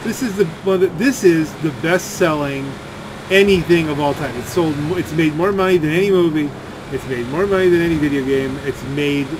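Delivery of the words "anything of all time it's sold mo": ordinary talk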